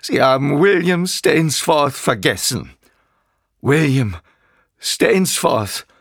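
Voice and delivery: high, wailing self-pitying complaint; whiny villain lamenting his own name. A narrator's reading. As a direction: wallow in your own hurt. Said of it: "Seine Stimme wurde weinerlich".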